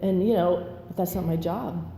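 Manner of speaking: normal talking voice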